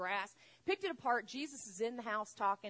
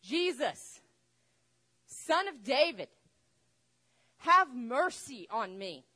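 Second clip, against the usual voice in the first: louder, insistent cry; yelling all the more; 2 semitones higher, 8 dB louder, slower than usual. Retold or imitated. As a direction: keep crying out even louder. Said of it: "He yells all the more".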